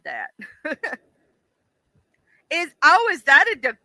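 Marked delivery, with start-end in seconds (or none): none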